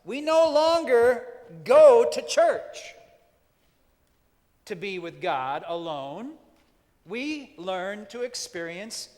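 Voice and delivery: loud, high, building preacher's cry; preaching at full force. Acted. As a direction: preach it out to the back row. Rising sermon climax.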